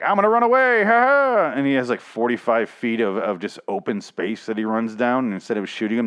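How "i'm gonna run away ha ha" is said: much higher, louder taunting squeal; mocking bad-movie villain. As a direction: taunt the shooters like a cartoon villain. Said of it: "the guy's like".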